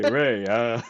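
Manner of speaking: loud, put-on character greeting; hammed-up running-joke impression; Lando voice. Teased by co-host about the running joke, hammed-up loud impression. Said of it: "This is the one Lando voice that you do".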